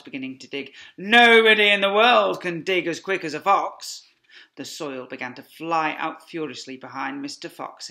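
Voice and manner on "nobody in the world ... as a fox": louder proud fox shout. Acted. Fox shouting to family while digging. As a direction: boast it loud while digging. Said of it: "shouted Mister Fox".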